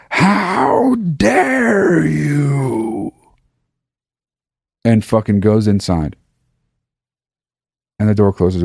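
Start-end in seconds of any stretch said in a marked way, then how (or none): 0.1-3.1 drawn out very slowly, higher, voice cracking; furious mom. Retold or imitated. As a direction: imitate an outraged mother confronting the teachers. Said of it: "my mom sticks her finger out into the room and she goes".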